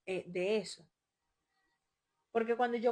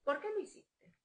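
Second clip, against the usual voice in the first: high-pitched rising ask; imitating her own question to her child; probing question; 11 semitones higher than usual. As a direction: ask it sharply, wanting an answer. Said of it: "yo se lo pregunto diez mil veces".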